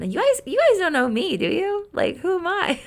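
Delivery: playful mock mystery. Teasing viewers about her masquerade mask.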